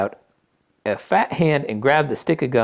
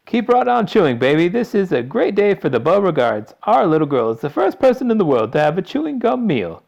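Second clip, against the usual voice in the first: boisterous father voice; 10 semitones higher, 9 dB louder than usual. A narrator's reading. Father boasting.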